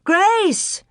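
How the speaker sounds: high mother's call, rising; mother searching, calling out. A narrator's reading. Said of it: "and she called".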